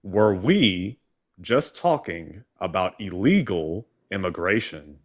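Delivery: very slow, deliberate, word by word; exasperated question. Real, not acted. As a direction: spell the question out slowly, as if for someone not listening. Exasperated repeat of a dodged question.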